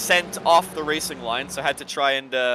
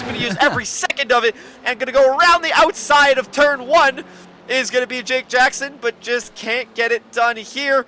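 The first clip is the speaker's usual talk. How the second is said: raised, louder race call, building with the battle; excited play-by-play; 8 semitones higher, 6 dB louder than usual. Real, not acted. Commentator calling a wheel-to-wheel overtake attempt, excited play-by-play. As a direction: drive the commentary up as the fight heats up.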